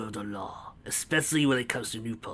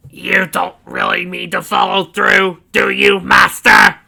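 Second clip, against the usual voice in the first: high, loud, slow squealing servant; servile henchman; 8 semitones higher, 16 dB louder, slower than usual. A narrator's reading. Biddleside stepping out of the shadows, loud and high. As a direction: whine up at the master.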